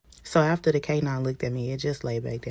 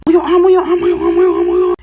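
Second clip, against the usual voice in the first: very high, loud, frantic repetition; panicked shout; 18 dB louder, faster than usual. Acted. Passenger to boyfriend as cop approaches, panicked shout. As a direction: shout it urgently, pitched way up.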